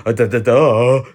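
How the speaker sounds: loud, dopey string of duhs; big sarcastic duh-duh-duh; mocking duh. Real, not acted. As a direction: hammer out the duhs, mocking the obvious. Mocks stating the obvious.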